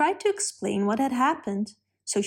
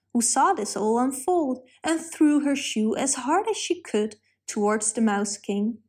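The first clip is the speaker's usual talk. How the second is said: ordinary delivery, nothing marked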